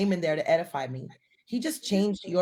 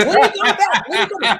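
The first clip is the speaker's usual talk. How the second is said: high, loud mimicry through laughter; laughing mock protest; 12 semitones higher, 9 dB louder, faster than usual. Co-host imitating the other's reaction, laughing loudly. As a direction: crack up while quoting the reaction.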